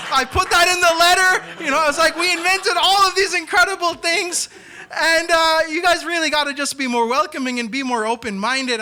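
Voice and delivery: excited retelling. Retold or imitated. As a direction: get carried away proudly.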